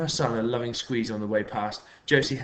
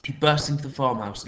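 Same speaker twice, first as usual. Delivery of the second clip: ordinary delivery, nothing marked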